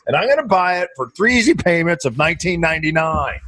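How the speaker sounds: high, hammed-up TV pitchman; mock infomercial. Host parodying a late-night TV ad, in a high mock pitchman voice.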